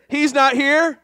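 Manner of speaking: loud, high call for the crowd; rallying call. Shown as a demonstration. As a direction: show them how it's done. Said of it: "let's show 'em how it's done".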